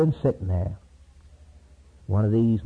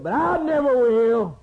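high, drawn-out acted vow; preacher acting a bold promise; emphatic vow; 10 semitones higher, 3 dB louder, slower than usual. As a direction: swear it slowly, high and insistent. Peter's boastful vow to Jesus, acted.

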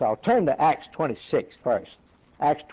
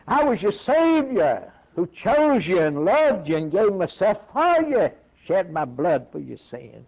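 voice of Christ; 6 semitones higher than usual. Acted. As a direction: plead as the Savior from above. Christ speaking to Saul.

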